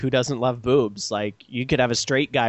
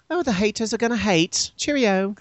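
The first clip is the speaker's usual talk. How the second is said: high, prim mock-British voice; proper British accent; 7 semitones higher than usual. Host imitates British podcast guest, proper English accent. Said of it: "so proper".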